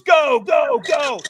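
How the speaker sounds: high, loud repeated shout; trainer urging. Retold or imitated. Trainer sends a firewalker onto coals, urgent shout. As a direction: yell them off the starting line. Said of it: "And he goes".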